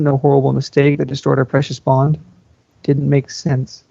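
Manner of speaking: plain reading voice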